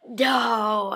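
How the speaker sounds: loud, happy "No!"; excited shout. Retold or imitated. Actor greeting a fan he recognises, a delighted loud cry. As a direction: blurt it out in delighted disbelief.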